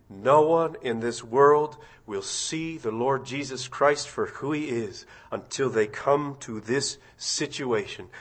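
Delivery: ordinary talk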